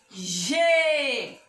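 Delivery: GÊ held long on the vowel; drawn-out syllable. Shown as a demonstration. Teacher joins G and E for children, drawn out. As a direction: stretch out "gê" as a model.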